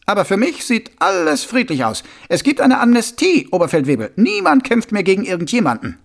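high-pitched, earnest subordinate; naive, protesting soldier. Acted. Soldier assuring his sergeant the fighting is over, high and protesting. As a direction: insist in a high voice that all is well. Voices Dickens in the story.

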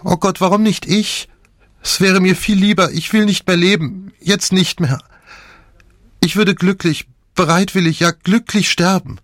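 high lamenting wail; tragic heroine sorrow. A narrator's reading. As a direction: lament it with despair. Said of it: "klagte sie".

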